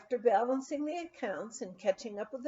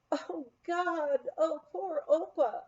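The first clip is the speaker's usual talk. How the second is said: higher, a touch of tearful grief; starting to cry; 7 semitones higher, slower than usual. A narrator's reading. Hearing on the phone that Father died, mildly tearful cry. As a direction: let the news break over you, mildly tearful. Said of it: "I started to cry".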